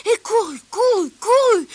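high, loud, urgent repeats; excited storytelling. A narrator's reading. Narrator chasing the fleeing bully, excited rising telling.

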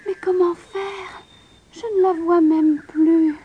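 a lightly voiced girl mourning her lost ball; tearful lament. A narrator's reading. Princess crying over her lost golden ball, lamenting. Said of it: "Elle se mit à pleurer et à se lamenter".

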